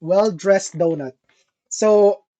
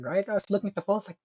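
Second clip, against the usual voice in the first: normal talking voice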